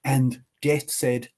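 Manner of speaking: plain reading voice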